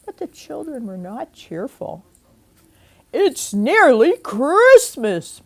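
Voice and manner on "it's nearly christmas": loud, slow booming aunt. Acted. Aunt greeting the sad children, booming. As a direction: boom it out with cheer. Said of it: "boomed Aunt Mim".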